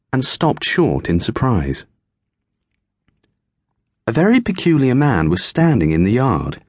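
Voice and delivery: plain reading voice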